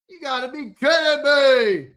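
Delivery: high, loud incredulous protest; hammed-up "you can't be serious"; mock disbelief. Host scoffing at a one ninety-nine super chat, mock disbelief.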